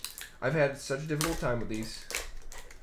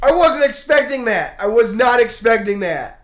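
much higher and louder, breathless with joy; elated shouting; excited; 9 semitones higher, 14 dB louder than usual. Real, not acted. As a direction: still reeling from the pull, loud and high. Still elated after the pull.

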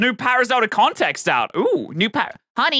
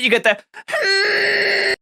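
hyped shout; 11 semitones higher, much slower than usual. Real, not acted.

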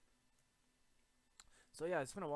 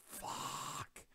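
breathy, drawn-out curse; exasperated curse; 4 dB louder than usual. Real, not acted. Gamer losing a fight, drawn-out breathy curse. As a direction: let it out as a long voiceless rasp. Killed in the game.

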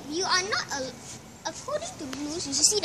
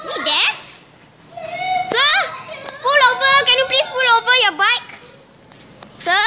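high, insistent hail; calling out; 8 semitones higher than usual. Pretend. Police telling a cyclist to pull over, calling out. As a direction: pretend officer calling after someone who ignores you.

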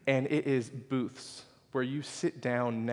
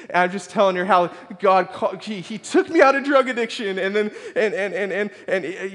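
high-pitched, stumbling ramble, easing off at the end; re-enacted overwrought venting; emotional outpouring; 8 semitones higher, 9 dB louder than usual. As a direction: spill it all out in a flustered run-on. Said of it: "I just start emotionally vomiting my life".

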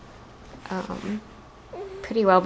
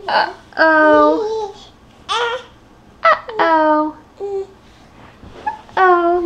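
singsong uh-oh; 8 semitones higher, 5 dB louder, much slower than usual. Real, not acted. Playful uh-oh to a baby.

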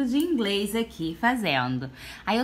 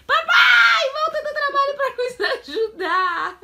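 loud high call for dad; 12 semitones higher, 9 dB louder, slower than usual. Real, not acted. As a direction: shout for papa laughing. Calling for dad.

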